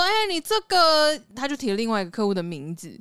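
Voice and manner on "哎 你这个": put-on slow, higher Taiwanese-accented boss; drawn-out Taiwanese Mandarin boss voice. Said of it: "我们老板台湾狗也很重".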